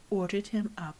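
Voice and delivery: ordinary delivery, nothing marked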